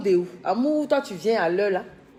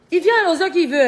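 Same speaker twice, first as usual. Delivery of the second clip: higher, louder exclamation; loud exclaim; 9 semitones higher, 10 dB louder than usual. Real, not acted. Scolding the man on camera, high loud exclaim.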